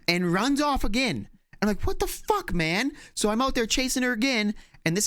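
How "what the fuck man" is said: high exasperated cry as the dog bolts; exasperated outburst. Retold or imitated. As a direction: snap in disbelief at the dog. Dog runs off again.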